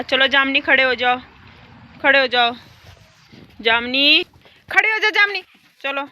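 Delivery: loud, high call to the cow; loud coaxing call to a cow. Real, not acted. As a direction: call her up from across the yard. Calling the cow to stand up.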